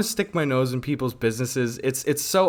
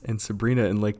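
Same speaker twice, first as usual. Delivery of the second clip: ordinary talk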